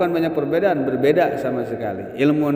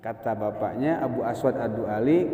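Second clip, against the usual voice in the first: usual speaking voice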